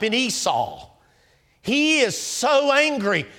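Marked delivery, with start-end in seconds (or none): 1.6-3.3 higher and slow, pressing each word; rising fervour; fervent. Real, not acted. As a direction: preach the anger home. Preaching Esau's fury.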